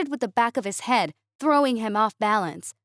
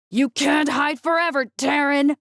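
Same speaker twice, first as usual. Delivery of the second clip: raised, growling alien taunt; 4 semitones higher than usual. A narrator's reading. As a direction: growl it after him. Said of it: "Zarthok growled".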